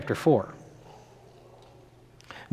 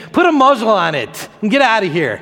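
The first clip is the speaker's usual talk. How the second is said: higher-pitched, forceful order; commanding; 5 semitones higher, 4 dB louder than usual. Retold or imitated. Jesus to that demon, commanding it to go. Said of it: "Jesus said to that demon".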